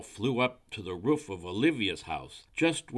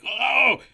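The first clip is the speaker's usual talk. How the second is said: high, loud pained "oh"; cry of pain; 12 dB louder than usual. A narrator's reading. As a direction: yelp with a frightful bellyache. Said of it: "most frightful stomachache".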